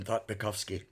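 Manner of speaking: usual speaking voice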